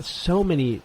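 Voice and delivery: normal talking voice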